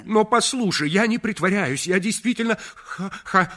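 очень высоко и громко, заикаясь и срываясь на плач; выкрикивает признание, запинаясь; сбивчивый крик. A narrator's reading. Заикаясь, кричит о любви. (Реджи, заикаясь, кричит Мадлен о женитьбе)

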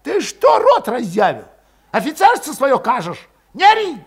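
high, loud furious cry; shouting back. A narrator's reading. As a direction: scream him down. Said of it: "выкрикнул Валет".

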